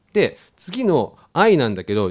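usual speaking voice

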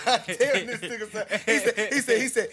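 can't stop laughing while telling. Real, not acted. Cracking up retelling.

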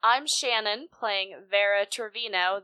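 normal talking voice

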